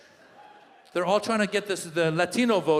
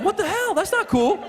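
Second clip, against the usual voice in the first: high-pitched hammed-up protest; mock indignation; 13 semitones higher than usual. Comedian protesting a nasty comment, high mock indignation. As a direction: squeal in offended disbelief.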